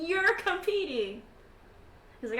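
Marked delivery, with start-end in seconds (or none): 0.0-1.2 high, loud and drawn out; amazed. Acted. Squadmate finds his general in the competition, high amazed exclamation.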